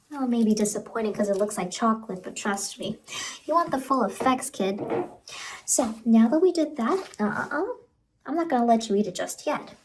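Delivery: normal talking voice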